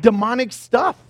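ordinary delivery

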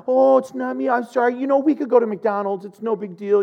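imitating his own meek apology; gentle pastor. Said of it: "I'm kind of that gentle".

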